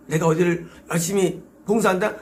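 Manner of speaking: ordinary delivery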